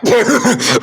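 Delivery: a loud, high hammed-up cackle; mock evil laugh; high mock villain laugh. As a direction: cackle like a smug king. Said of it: "Carlos goes like".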